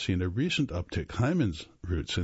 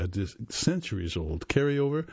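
normal talking voice

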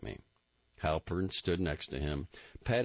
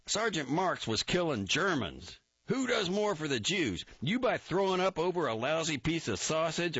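higher, louder furious officer; blowing up; 13 semitones higher, 3 dB louder than usual. A narrator's reading. Captain berating a recruit in front of a hero, furious. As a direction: explode at him, building to a roar. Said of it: "Barrett blew up".

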